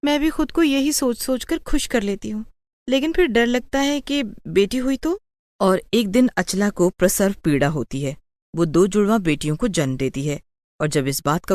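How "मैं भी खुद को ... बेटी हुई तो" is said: anxious daughter. Acted. Daughter still anxious about a girl.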